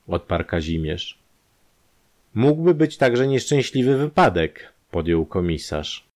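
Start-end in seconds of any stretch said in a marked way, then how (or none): 2.3-4.7 higher and louder, weighing the idea; commissioner's suggestion. A narrator's reading. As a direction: offer the theory carefully.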